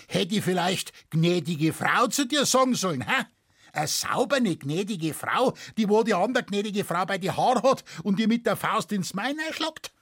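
indignant farmer's sarcasm; sarcastic Bavarian peasant. Acted. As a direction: scoff at her in broad dialect. Witness mocks farmer's wife.

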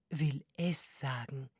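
geheimnisvoll geflüstert; flüsternd. Acted. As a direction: leise wie ein Geheimnis verraten. Zauberin verrät leise Geheimnis. (geflüstert)

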